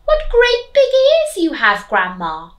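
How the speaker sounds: put-on high, loud little girl voice. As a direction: sing it out like a curious little girl. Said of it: "and then she said".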